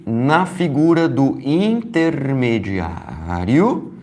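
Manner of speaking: usual speaking voice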